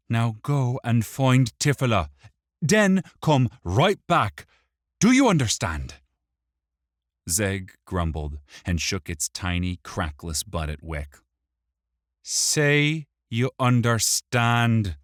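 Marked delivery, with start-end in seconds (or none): 0.1-5.9 loud, high-pitched orders; bossy command. Acted. Wick sends the imp to find Tifila, bossy. 12.2-15.0 loud, high, slow command; insistent repeat. A narrator's reading. Wick makes the imp confirm, insistent. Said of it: "Wick repeated".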